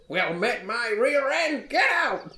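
put-on high, indignant old-timer yell; scoff, then holler them off; crotchety old man. Old man throwing a greeting back at adventurers, crotchety yelling. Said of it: "this crotchety old man comes running out".

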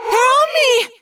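very high, loud panicked scream; terrified scream. Acted. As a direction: scream in terror. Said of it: "Cassie screamed".

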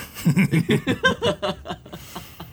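devilish laugh. Pretend. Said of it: "His laugh is one to die for".

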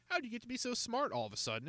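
high and fast, quick curious question; curious boy. A narrator's reading. Encyclopedia questioning Jacob, high and quick. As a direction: ask it quickly, intrigued.

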